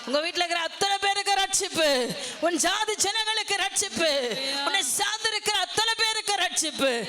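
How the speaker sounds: rising preaching fervour. Acted. Preacher proclaiming salvation.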